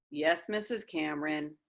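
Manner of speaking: low, soft, sulky mumble; boy mumbling a reluctant reply to his teacher; mumbled. A narrator's reading. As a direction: mumble it under your breath to the teacher. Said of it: "mumbled Kyle".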